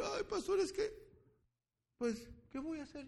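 high, whiny mock lament; desperate churchgoer. Pastor mocking a member asking what to do, high whine.

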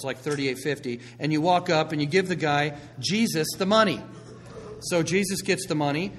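loud, driving preacher storytelling; preaching at full force. Acted. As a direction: sell the picture big to the congregation. Preaching the popcorn parable.

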